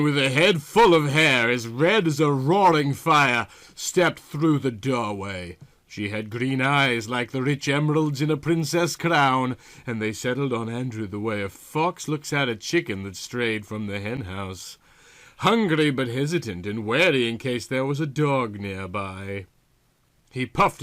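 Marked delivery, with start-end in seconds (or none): none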